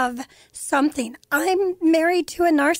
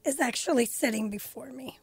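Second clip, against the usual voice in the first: softer, teary and moved; 7 dB quieter than usual. Real, not acted. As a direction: voice fading with emotion. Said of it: "I'm getting teary".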